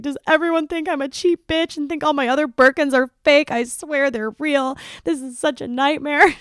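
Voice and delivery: high, loud mock panic; hammed-up imitation of a frantic friend; mortified friend. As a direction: protest in comic distress, desperate to be believed. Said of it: "My friend was mortified".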